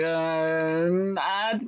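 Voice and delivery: long drawn-out hesitation; stalling. Retold or imitated. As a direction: stretch the words while dodging the question. Cornered man stalls before answering.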